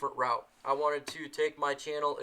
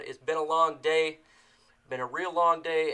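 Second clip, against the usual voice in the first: ordinary delivery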